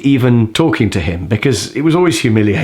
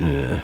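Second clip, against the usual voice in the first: deep dismissive grunt, much softer; low grunt; 10 semitones lower, 8 dB quieter than usual. Retold or imitated. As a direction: grunt without looking up. Jack's grunt retold.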